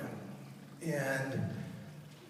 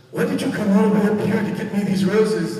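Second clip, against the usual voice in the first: higher, louder, faster, imitating his moved mother; imitating mom; 6 semitones higher, 9 dB louder, faster than usual. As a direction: echo mom's surprised question, quicker and higher. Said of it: "And then she goes".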